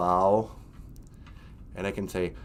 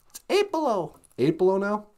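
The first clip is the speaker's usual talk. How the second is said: high puppet voice; 9 semitones higher than usual. Pretend.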